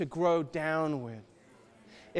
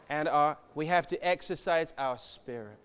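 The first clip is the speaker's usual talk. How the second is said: ordinary talk, nothing marked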